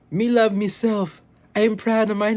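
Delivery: high, loud, proud mock Jamaican kid; sassy Jamaican boy. Little boy standing up to mom, imitated Jamaican sass. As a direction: belt out self-love in a put-on Jamaican child voice. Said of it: "there's a lot of sass".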